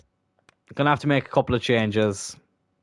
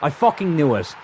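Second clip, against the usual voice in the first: real annoyance, slightly raised; irritated first reaction; annoyed; 3 semitones higher than usual. Real, not acted. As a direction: curse in exasperation, holding back from shouting. Gamer reacts to conceding goal.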